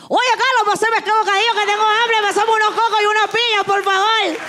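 high, fast, exaggerated Venezuelan accent; mocking Venezuelan accent. Friends imitating her asking Carlos for food, cartoonish Venezuelan accent. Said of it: "me imitan y me imitan así".

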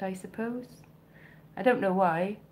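usual speaking voice

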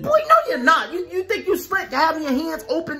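scolding mom. Acted. Mom catching her son peeking through his hands. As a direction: bust the peeking kid.

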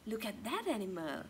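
usual speaking voice

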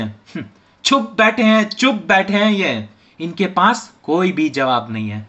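normal talking voice

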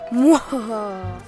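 slow, drawn-out villain cackle; evil cackle. Acted. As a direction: cackle like a gleeful mad scientist. Villain laughs after plotting.

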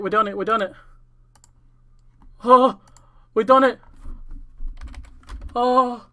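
overjoyed screams of victory; real ecstatic shouting. Real, not acted. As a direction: shout it out, you finally did it after years. Finally getting the fire cape.

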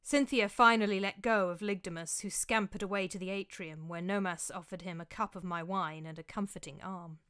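ordinary delivery

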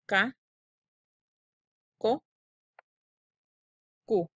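"K, ko, ku" each said apart with a pause; k syllables. Shown as a demonstration. Teacher modelling syllables for kids to repeat. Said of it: "Repitan conmigo".

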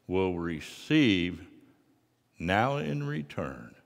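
normal talking voice

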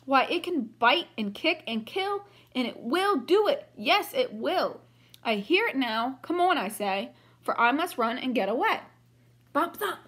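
lively read-aloud storybook voice; reading to children. A narrator's reading. Teacher reading a picture book to the class, lively. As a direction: read it brightly for young listeners.

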